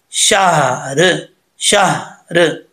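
शहर drawn out slowly with a clear final र; slow Quranic recitation. Shown as a demonstration. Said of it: "इसको सही पढ़ने के लिए क्या है?". (शहर को धीरे से, आख़िरी र साफ़ बोलते हुए)

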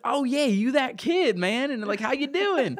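a friendly Southern pastor drawl, put on high and unhurried; Southern twang. Pastor greeting an old acquaintance in a coffee shop, Southern twang, high. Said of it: "he's got this little Southern twang".

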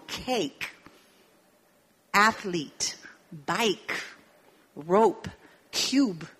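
"cake", "athlete", "bike", "rope", "cube" each set apart; English example words. Shown as a demonstration. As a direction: list the examples clearly. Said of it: "Por ejemplo". (palabras de ejemplo en inglés, claras)

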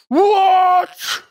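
outraged shout. Acted. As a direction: blurt it in outrage. David hears the insult.

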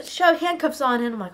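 ordinary delivery, nothing marked

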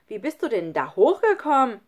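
put-on loud, astonished child's call; ausgerufene Frage. A narrator's reading. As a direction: call the question up to the roof. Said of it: "rief Nele". (laut gerufene Frage)

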